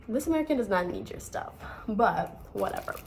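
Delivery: normal talking voice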